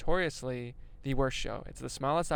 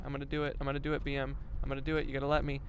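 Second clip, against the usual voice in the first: normal talking voice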